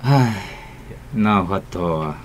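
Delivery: mildly resigned, with a heavy sigh; big resigned sigh. Acted. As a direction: sigh and give in. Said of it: "吐一个大气".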